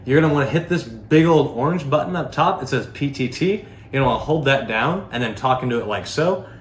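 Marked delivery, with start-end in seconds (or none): none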